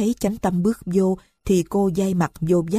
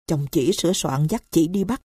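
ordinary delivery

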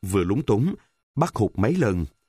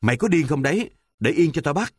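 higher-pitched scolding cry; mild yell; 7 semitones higher, faster than usual. A narrator's reading. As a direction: narrator voicing a boy yelling in protest. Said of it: "nó la toáng lên".

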